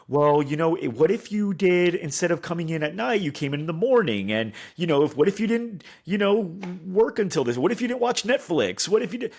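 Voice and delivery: high-pitched, over-eager pleading pitch; pushy convincing. Shown as a demonstration. Salesperson convincing a doubtful prospect, pushy pleading. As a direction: pile on suggestions, trying too hard. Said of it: "Convincing them sounds like this".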